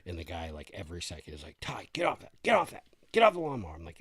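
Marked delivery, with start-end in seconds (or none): 1.6-2.3 put-on raised, higher call to a kid; a dad warning his boy off the mower; retold dad scolding. Dad scolding son in story. 2.4-3.7 put-on much higher, loud repeated yell at a kid; a father shouting his son off the lawnmower; retold dad yelling. Dad scolding son in story.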